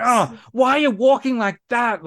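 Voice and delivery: put-on teen whine. Mocking teen daughter's complaint.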